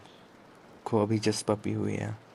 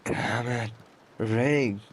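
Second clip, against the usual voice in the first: curse, then the name called louder; exasperated, rising to a raised voice; angry outburst; 3 dB louder, much slower than usual. Acted. Gang boss cursing his man over her injury, angry.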